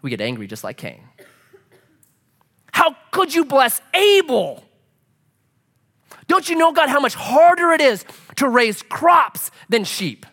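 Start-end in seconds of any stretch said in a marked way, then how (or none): 2.7-10.3 high, loud aggrieved complaint; Cain's outraged whine, higher and louder. Retold or imitated. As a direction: rail at God as Cain. Voicing Cain angry at God.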